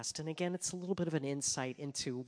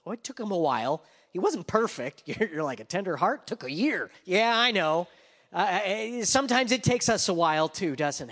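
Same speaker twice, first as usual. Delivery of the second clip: loud, high, slowing preacher's call; full-force preaching; 6 semitones higher, 13 dB louder than usual. Acted. Preacher drives home David's guilt, loud preaching. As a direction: preach it out over the congregation.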